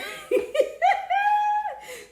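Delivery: high, long laughing squeal; real high laughter; high shrieking laugh. Real, not acted. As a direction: crack up at your own joke. Laughs at her own teasing.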